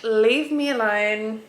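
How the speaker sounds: put-on high, loud, slow grumpy baby voice; voicing the baby. Mum voices her sleepy baby for viewers, high and whiny. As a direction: pretend cranky newborn. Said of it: "She's like".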